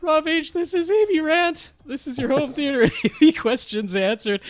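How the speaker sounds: high, hammed-up villain voice, laughing through it; Cobra Commander voice. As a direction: do a silly masked villain. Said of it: "Uh, that voice, it just, it got me".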